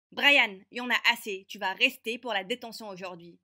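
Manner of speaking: loud, stern rebuke; stern teacher. Acted. Teacher silencing the rude boy, loud and stern. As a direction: cut him off and lay down the law. La prof a frappé la table.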